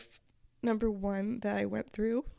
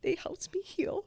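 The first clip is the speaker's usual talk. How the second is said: high, breathy whispered line; whispered much higher, barely holding together; tearful whisper; 11 semitones higher than usual. Real, not acted. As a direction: let it slip out in a whisper, moved. Recounting healing from a vision.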